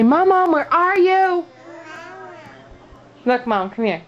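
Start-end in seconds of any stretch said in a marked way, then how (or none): 0.0-1.4 put-on prompting toddler line. Shown as a demonstration.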